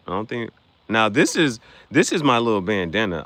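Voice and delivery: ordinary talk, nothing marked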